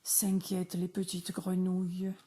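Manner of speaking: usual speaking voice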